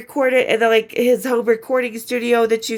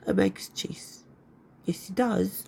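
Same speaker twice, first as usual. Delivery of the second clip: hushed, whispered repeat; whispered aside; 11 dB quieter, slower than usual. Real, not acted. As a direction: drop to a secretive whisper. Hushed repeat of her own remark.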